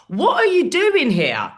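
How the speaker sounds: high, loud and slow, baffled outrage; exasperated shout. Retold or imitated. Comic shouting at a drunk mate in the front row, high and loud. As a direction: stretch it out in disbelief at him.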